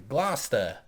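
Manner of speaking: put-on Gloucester said slowly as GLAH-stuh in a Boston accent; a light Matt Damon Boston twang on Gloucester. Host showing off a Matt Damon impression, a slow Boston accent. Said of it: "That's my Matt Damon, Damon impression".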